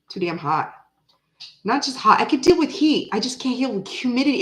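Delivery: normal talking voice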